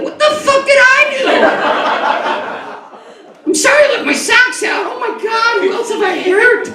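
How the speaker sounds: put-on high, shouting, horrified kid; panicked child. Child in church panicking to his mother, high mock shout. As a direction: shriek it like a guilty little boy.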